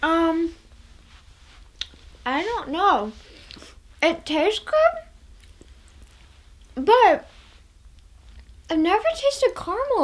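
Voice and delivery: words around sticky candy; talking while chewing. Real, not acted. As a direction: mouth partly full. Seen 0: Candy put in mouth at -12; jaw working, lopsided mouth while talking.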